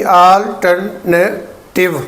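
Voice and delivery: अल्टरनेटिव very slowly, al, ter, na, tiv; spaced-out अल्टरनेटिव, short na and clear tiv; syllable-by-syllable. Shown as a demonstration. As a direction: pause between each syllable of अल्टरनेटिव. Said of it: "नेटिव नहीं".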